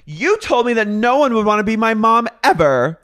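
high, loud, hammed-up outrage; indignant outburst. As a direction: belt out the accusation in mock fury. Retold outburst in quarrel.

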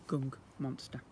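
ordinary delivery, nothing marked

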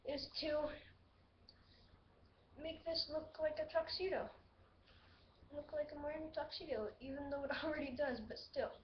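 muttered half to himself, fast; quiet self-talk mumble; mumbling to himself. Real, not acted. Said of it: "you probably couldn't hear".

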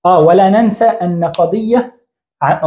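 usual speaking voice

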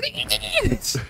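high drawn-out death scream; death cry. Pretend. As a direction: let out a silly dying shriek. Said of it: "can you do one of your death screams?".

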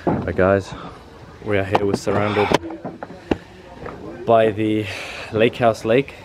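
normal talking voice

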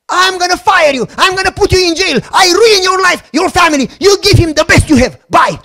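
acted high, very loud tirade; furious threatening. Prime minister phoning a factory boss, furious shouting. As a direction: yell threats down the line, full force.

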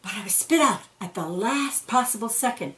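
usual speaking voice

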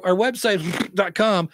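mock a host slurring through a familiar web address; rushed, garbled mock. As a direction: blurt the web address as an unintelligible blur before "dot com". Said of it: "They say it so fast".

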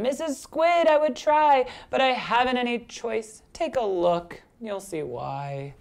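plead, then sink away dejectedly; helpless pout-pout fish. Acted. Fish excuses himself to squid, sinking plaintive voice.

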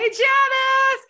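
loud high shout of a name; spotting a friend and shouting her name; calling out. Real, not acted. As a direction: call out Janice with delight across the room. Calling out to a viewer.

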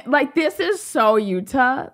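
ordinary talk

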